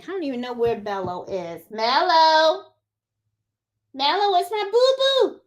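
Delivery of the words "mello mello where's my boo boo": singsong calling to a pet; calling the dog. Real, not acted. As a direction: call out for your beloved dog, pitch rising high.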